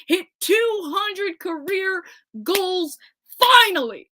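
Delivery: rising to a high, loud shout on finally; thrilled fan shouting; excited. Real, not acted. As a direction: build from slow to a burst. Celebrating milestone goal.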